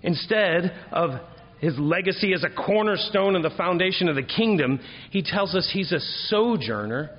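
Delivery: high, loud, ringing preacher's cadence; preaching at full force. Acted. Pastor exhorting his congregation about David, full force. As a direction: proclaim it to the back pews.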